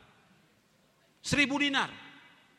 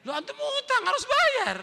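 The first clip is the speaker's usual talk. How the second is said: indignant retort; 14 semitones higher, 3 dB louder than usual. Retold or imitated. Lender retorts in disbelief.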